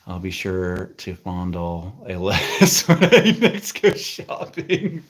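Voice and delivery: slow start breaking into laughter; real giggles overtaking the words; reading through laughter. Real, not acted. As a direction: crack up while reading it aloud. Reads a comment, cracking up.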